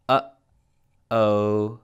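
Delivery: "Uh-oh" with a clear gap between the two sounds; teacher's slow demo of the catch in "Uh-oh"; glottal stop. Shown as a demonstration. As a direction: break "Uh-oh" in the middle with a held glottal stop. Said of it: "the gap between the two sounds".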